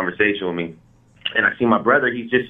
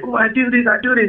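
put-on much higher, giddy kid boasting; proud showing off; 13 semitones higher than usual. Kid showing his new rap to school friends, giddy. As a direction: show it off to your friends.

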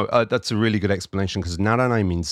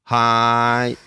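cheerful hi; 3 semitones higher, 6 dB louder than usual. Shown as a demonstration. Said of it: "this is my favorite word in Japanese".

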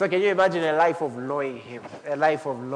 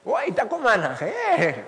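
high, startled cry of recognition; shocked greeting; 7 semitones higher, slower than usual. Retold or imitated. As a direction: act out the surprise of old friends. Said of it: "They're like".

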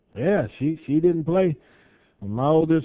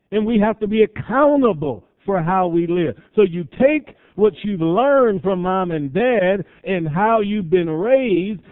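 ordinary delivery

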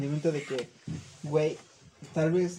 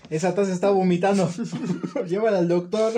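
laughing joke; 3 semitones higher, 4 dB louder than usual. Real, not acted. Joking about a dripping cup.

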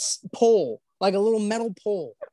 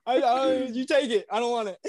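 mock surrender, amused; 7 semitones higher than usual. Meekly yielding the block, laughing.

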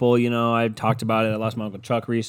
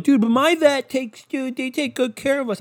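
mocking other people; 14 semitones higher, 4 dB louder than usual. Mimicking people who defend their vets, high and dopey. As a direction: parody the vet defenders in a silly high voice. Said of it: "I know some people are like".

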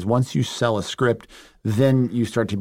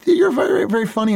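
very high-pitched compliment; light, high retold voice; acted fan praise; 13 semitones higher, 3 dB louder than usual. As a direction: imitate a fan's praise, pitched way up.

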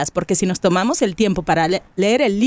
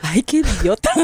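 usual speaking voice

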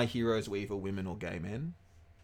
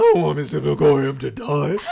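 hammed-up tearful wail, louder, slow and laughing; mock sobbing; 3 semitones higher, 10 dB louder than usual. Retelling crying at a kids' movie, mock sobbing. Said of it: "I'm like".

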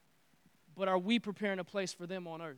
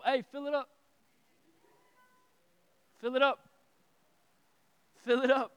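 higher, louder call across a room; yelling across the room; 5 semitones higher, 6 dB louder than usual. Shown as a demonstration. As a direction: call it out to someone far away. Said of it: "you need to shout across the room".

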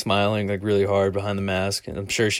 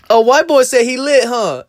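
customer's voice; 9 dB louder than usual. Retold or imitated. Customer's mocking reply voiced.

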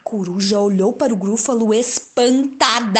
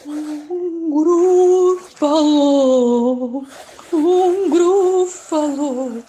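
slow, drawn-out gasp of awe; awed gasp; 5 semitones higher, 3 dB louder, much slower than usual. Acted. Narrator gasping the monster's name to listeners, slow and awed. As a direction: breathe the name in wonder.

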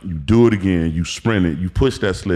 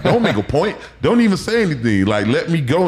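higher, whiny plea to be let off; exasperated pleading; 7 semitones higher than usual. Retold or imitated. Recalling his tired self begging the coach to stop, exasperated. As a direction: beg him to stop talking. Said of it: "And it's like".

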